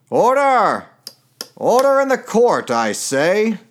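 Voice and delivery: booming high courtroom judge. Pretend. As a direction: bang the gavel, call for order. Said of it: "That would be my judge voice".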